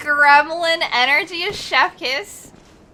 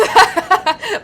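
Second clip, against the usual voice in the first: squeaky gleeful giggle; gremlin laugh; 6 semitones higher, 9 dB louder than usual. Real, not acted. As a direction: cackle like a mischievous gremlin. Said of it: "my gremlin laugh?".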